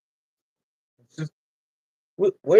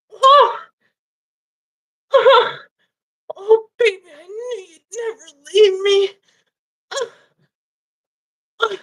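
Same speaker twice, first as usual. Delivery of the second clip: high, sobbing relief; high crying vagina voice; 12 semitones higher, 5 dB louder than usual. Acted. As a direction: cry it out way up high. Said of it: "But you gotta talk like that".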